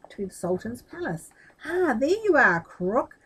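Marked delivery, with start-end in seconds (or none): 1.6-3.2 higher, louder angry outburst; angry cry. A narrator's reading. Sultan confronting Aladdin, angry and loud. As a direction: catch him out, indignant. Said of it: "the Sultan cried".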